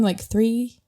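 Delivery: usual speaking voice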